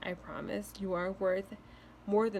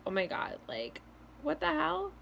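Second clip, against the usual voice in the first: creepy freakout; 6 semitones higher than usual. Pretend. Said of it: "not trying to sound like a freaking creep".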